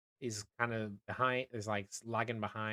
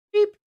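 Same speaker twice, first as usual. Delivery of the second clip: short, high, loud electronic beep; imitated beep; 16 dB louder than usual. Game master sounds the beacon's beep, short imitated beep. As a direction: beep like a little device. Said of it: "and then you hear, like, a".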